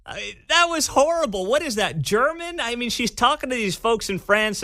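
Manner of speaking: high-pitched, incredulous outburst; appalled disbelief. Real, not acted. Host reacting to Harris's clip, high incredulous disbelief.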